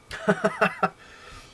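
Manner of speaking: high, loud burst of laughter. Real, not acted. Laughs after the story.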